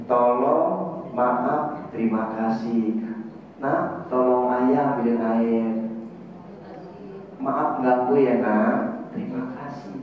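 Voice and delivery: low, soft and slow, each polite word given tenderly; gentle polite request. Shown as a demonstration. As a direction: ask your family gently and kindly. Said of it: "saya kalau ngomong, saya pikirin dulu". (pelan dan lembut penuh sopan)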